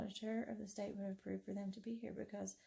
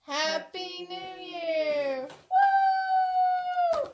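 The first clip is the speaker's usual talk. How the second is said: drawn-out cheering "Happy New Year"; new year countdown crowd; 10 semitones higher, 17 dB louder, much slower than usual. Real, not acted. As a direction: cheer it out slowly and loud. Midnight cheer.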